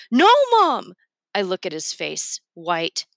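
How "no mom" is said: high, loud cry of protest. A narrator's reading. Scared son protesting to his mother, crying out. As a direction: cry out sharply in a much higher voice. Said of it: "Matt cries".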